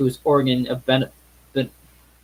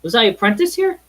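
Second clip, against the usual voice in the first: amazed; 10 semitones higher, 5 dB louder than usual. Acted. Scrooge recognizing his old workplace.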